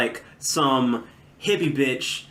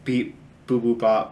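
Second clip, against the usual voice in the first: robot voice; 3 semitones lower than usual. Pretend. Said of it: "Calculator noises".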